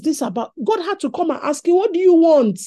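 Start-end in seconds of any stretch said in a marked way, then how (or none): 1.8-2.6 loud booming question; 1 mock God voice. Preacher voicing God asking Solomon, loud and booming. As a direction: boom it out as God.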